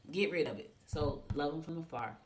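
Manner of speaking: ordinary talk, nothing marked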